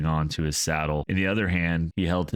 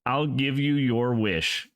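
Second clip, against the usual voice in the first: mad defiance; 8 semitones higher, slower than usual. A narrator's reading. Mad inventor answers ghost king.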